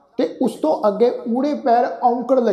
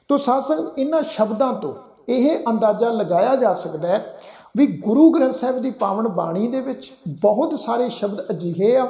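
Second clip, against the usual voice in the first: usual speaking voice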